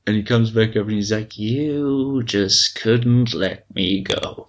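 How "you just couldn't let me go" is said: slow, drawn-out villain taunt; lightly acted, lingering tease; Joker. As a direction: stretch the words out like a gloating villain. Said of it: "and he's laughing".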